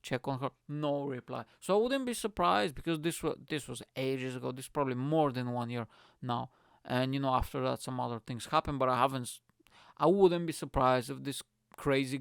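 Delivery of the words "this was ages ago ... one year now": ordinary delivery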